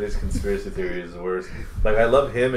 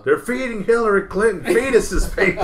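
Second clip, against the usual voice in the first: Alex Jones rant impression; 11 semitones higher than usual. Friend imitates Alex Jones on a podcast, high mock rant. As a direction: shout it like a paranoid talk host.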